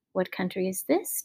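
soft, low musing question; wondering to himself. A narrator's reading. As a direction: murmur it as a private thought. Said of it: "wondered to himself".

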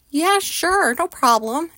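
high-pitched, overly easy yes; mock-breezy acceptance; falsely agreeable. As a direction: agree too readily, masking the dread. Feigned willingness, both knew otherwise.

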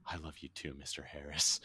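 very soft, tender mock declaration; Ron Fox's hushed reply. Alarmed Ron at the car window, hushed loving reply. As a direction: murmur it back softly and earnestly. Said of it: "and he just goes".

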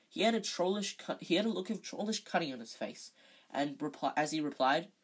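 plain reading voice

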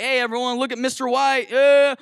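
high, loud fake hype; put-on showboating call; mock self-promotion. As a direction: cheer yourself on hammily. Mock showing off to crowd.

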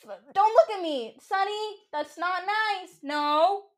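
high-pitched, drawn-out telling-off; scolding a pet. Real, not acted. Owner telling off the dog after it peed, scolding.

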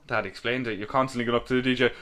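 usual speaking voice

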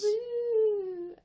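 squealing woo; high-pitched cheer; high excited woo. Acted. Cheer for the hat.

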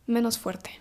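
ordinary delivery

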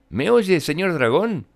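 usual speaking voice

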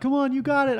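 high-pitched cheering on; mock trainer encouragement. Son playing her personal trainer at bench press, mock encouraging.

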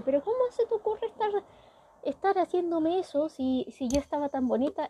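high, fast indignant whine; whiny girl voice. Retold or imitated. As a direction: whine like a vain girl whose makeup got ruined. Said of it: "Y ella le dice". (voz de chica quejona, aguda y rápida)

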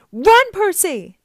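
high, loud, drawn-out yell; shouted warning. A narrator's reading. Annabeth calls to Percy across the statuary, shouting in alarm. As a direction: scream for him to get away. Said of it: "Annabeth's voice shouted".

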